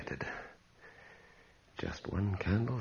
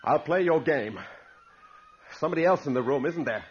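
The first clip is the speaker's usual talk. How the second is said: much higher and louder, taunting; defiant loud challenge; 10 semitones higher, 6 dB louder than usual. Acted. Man loudly taunting whoever hides in the room. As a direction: call out to the invisible prankster.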